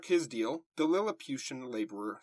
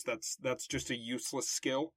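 ordinary talk, nothing marked

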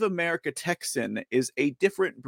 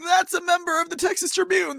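mock quote; 13 semitones higher, faster than usual. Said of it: "And I was like".

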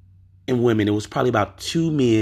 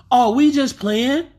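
put-on high, louder kid voice; dismissive teenage boy; 12 semitones higher, 4 dB louder than usual. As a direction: shrug it off like a teen. Boy voiced in the retold story.

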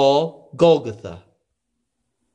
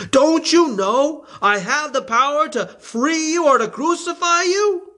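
higher, rising indignant question; Pilate threatening; 13 semitones higher than usual. A narrator's reading. Pilate pressing Jesus, higher and indignant. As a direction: assert his power over him.

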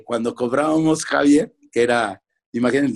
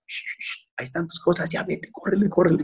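soft, higher-pitched conspiratorial hush; hushed urging; 5 semitones higher, 6 dB quieter than usual. Retold or imitated. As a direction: imitate dad sneaking his son out. Father secretly helps son slip out.

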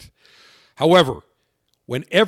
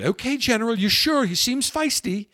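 higher-pitched imitation of a British hostess; British accent; 7 semitones higher than usual. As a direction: check with him in a high British accent. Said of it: "in her British accent".

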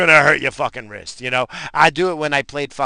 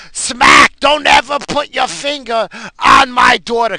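loud, high mock shout; angry mom; 8 semitones higher, 10 dB louder than usual. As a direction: imitate a furious mother telling off a teacher. Acts out angry mom slapping teacher.

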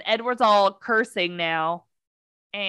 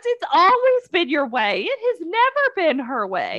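high-pitched indignant protest; exasperated rant; 6 semitones higher than usual. Real, not acted. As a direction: argue back, pitched up and fed up. Outraged rebuttal of quoted line.

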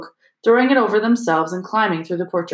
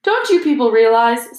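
raised, louder, patronizing question; condescending; 4 semitones higher, 6 dB louder than usual. A narrator's reading. Lockhart to his class, condescending. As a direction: talk down to a dim audience. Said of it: "speaking slowly, as though they were all a bit dim".

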